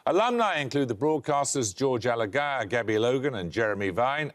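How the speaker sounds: usual speaking voice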